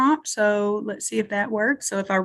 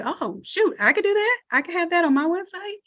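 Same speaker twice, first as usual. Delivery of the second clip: higher-pitched voice of someone struck by an idea; imagined impressed customer; 8 semitones higher than usual. Acted. Entrepreneurs seeing compelling merch for their website, pitched-up eager realization. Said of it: "that makes them say".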